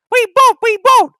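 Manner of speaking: very high, loud squeal; screaming trucks. Acted. The trucks refusing James, very high and loud. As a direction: shriek it like stubborn trucks. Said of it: "screamed the trucks".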